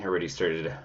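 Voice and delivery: ordinary talk, nothing marked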